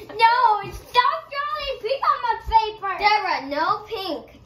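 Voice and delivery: mock angry baby. Sibling voices the baby scolding her, loud and high. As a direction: play the bossy baby shouting orders. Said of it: "He's like".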